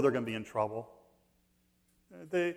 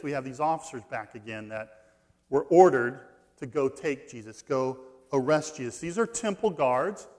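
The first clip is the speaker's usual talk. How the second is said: usual speaking voice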